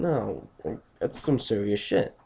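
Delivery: ordinary talk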